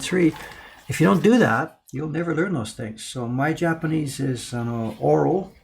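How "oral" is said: ordinary talk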